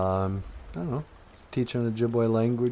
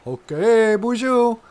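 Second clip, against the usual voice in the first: very high, loud character voice; high-pitched sidekick, full volume; Nana Bushu; 10 dB louder, slower than usual. Acted. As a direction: pipe up in a loud, high little voice. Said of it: "This over here is Nana Bushu".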